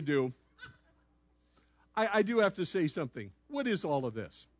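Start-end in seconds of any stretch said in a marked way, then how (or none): none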